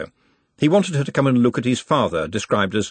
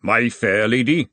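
put-on posh accent; slower than usual. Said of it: "You don't have to sound like".

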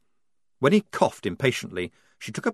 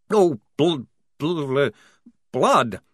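stammering; 4 semitones higher, 4 dB louder, slower than usual. Acted. Vampire stammering over forbidden word.

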